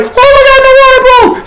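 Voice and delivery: put-on very high, loud shrieking girl; panicked girl. Pregnant girl in labor to the group, hammed-up shriek. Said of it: "she's like".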